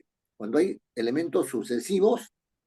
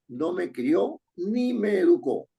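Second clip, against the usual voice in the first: no me crió ni me educó, said slowly and higher; slow deliberate reading; 6 semitones higher, slower than usual. Shown as a demonstration. Teacher offering the class a second version of the sentence, slowly. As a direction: spell out the option slowly, leaning on ni. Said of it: "la otra posibilidad".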